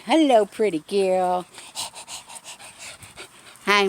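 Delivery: high, loud cooing pet talk. Real, not acted. Owner greeting her dogs one by one, high cooing baby talk. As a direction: coo at the dogs like babies.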